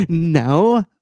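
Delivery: high laughing "no". Real, not acted. Laughing back at a friend.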